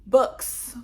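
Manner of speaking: stunned at the huge message walls; shocked. Retold or imitated. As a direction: blurt it out in disbelief. Seeing long messages.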